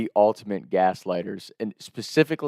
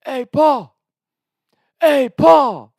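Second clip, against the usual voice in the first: high, loud taunting call; singsong shout to annoy dad upstairs; mock yelling up the stairs; 11 dB louder, much slower than usual. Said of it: "they keep yelling up".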